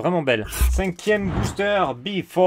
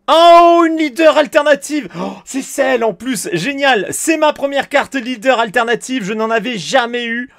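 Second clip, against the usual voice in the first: much higher and much louder, bursting with real joy; real excitement; 8 semitones higher, 7 dB louder than usual. Real, not acted. Collector pulling his first alternate leader card, yelling with real joy. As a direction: shout it out as the dream card finally appears. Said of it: "je suis très très content".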